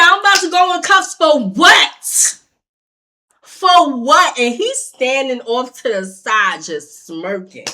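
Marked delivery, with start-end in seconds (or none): 0.0-4.4 loud, high indignant challenge; re-enacted incredulous shout; outraged protest. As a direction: yell back in disbelief at the threat. Retold protest at threatened cuffs.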